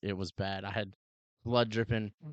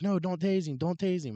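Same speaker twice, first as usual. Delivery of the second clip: high, louder frantic "don't tase him"; whiny high-pitched officer plea; mock pleading; 9 semitones higher, 4 dB louder than usual. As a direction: imitate the panicky partner. Other officer pleading, retold.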